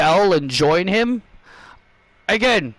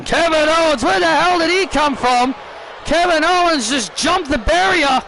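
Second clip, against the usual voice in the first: excited shouting commentator; 9 semitones higher than usual. Acted. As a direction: call the ambush like live TV. Commentator reacting to ambush.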